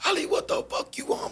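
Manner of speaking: squeaky high mock of an annoyed inmate. Inmate snapping at the joker, in a squeaky mock voice. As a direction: pitch way up, whisper-thin and dismissive.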